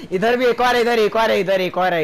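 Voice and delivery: high, loud spotting call; loud enemy callout. Real, not acted. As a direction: warn the team sharply.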